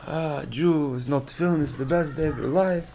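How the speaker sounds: imitating the excited Bukharian man; mock excited foreign man. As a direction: sell it as the best day ever. Excited man's foreign-accent praise.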